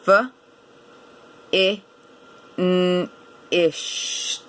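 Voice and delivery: FINISHED sounded out, f, i, n, ish, t; "F, I, N, ished" as separate sounds with long gaps. Shown as a demonstration. Teacher counting sounds in finished, sound by sound. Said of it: "Let's try counting the sounds".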